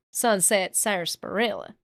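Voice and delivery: "Sunset Sarsaparilla" with every syllable spelled out; over enunciating. Shown as a demonstration. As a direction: carefully sound out each part of "Sunset Sarsaparilla". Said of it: "I tried to enunciate every part of that word".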